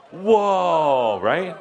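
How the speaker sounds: long drawn-out amazed whoa; awed whoa. Retold or imitated. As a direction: stretch it out in wonder.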